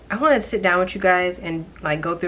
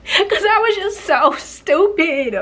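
high, loud, laughing anger; half-laughing fresh outrage; angry again; 6 semitones higher, 5 dB louder than usual. Real, not acted. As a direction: fume and laugh at once. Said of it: "I'm getting angry all over again".

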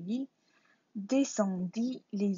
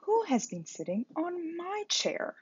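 higher mother bear voice; 9 semitones higher than usual. A narrator's reading. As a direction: ask it as mama bear, pitched up. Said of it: "asked Mother Bear". (Maman Ours demande d'une voix aiguë)

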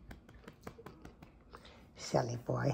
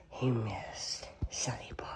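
hushed whisper with a sharp gasp; 3 semitones lower, 6 dB quieter, slower than usual. Real, not acted. Owner whispers to her cat, gasp then whisper. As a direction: whisper it so the cat stays calm.

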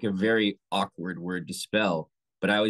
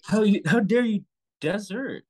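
much higher, louder mock indignation; hammed-up outrage; 12 semitones higher, 5 dB louder than usual. Teasing friends with the line, hammed-up outrage. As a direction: overplay the shock of desertion. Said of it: "sell the word differently".

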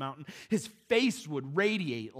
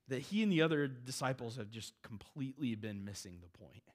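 normal talking voice

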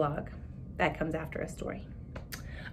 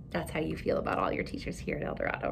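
ordinary delivery

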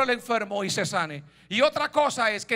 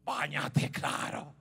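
low, soft mock consent, dying away; sarcastic permission; 4 semitones lower, 4 dB quieter, slower than usual. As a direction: grant it so it sounds like a warning. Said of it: "le está diciendo que sí con la palabra". (permiso sarcástico, grave y bajito)

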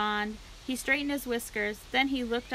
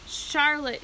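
higher, louder moan of a name; anguished moan; 5 semitones higher, 5 dB louder than usual. A narrator's reading. Pig grieving his dying friend, moaning. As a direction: moan it with grief. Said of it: "he moaned".

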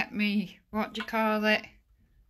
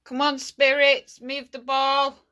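loud, higher urging; calling out; 4 semitones higher, 7 dB louder than usual. Real, not acted. Ghost hunter urging spirits to move the ball, calling out. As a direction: coax the spirits with a raised voice.

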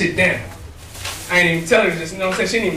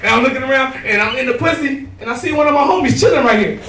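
ordinary delivery

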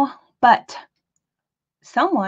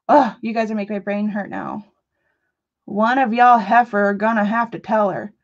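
ordinary talk

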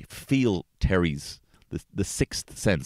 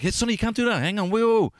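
sudden yell. Retold or imitated. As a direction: snap at him to stop, full alarm. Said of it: "I actually did get a bit of a rise".

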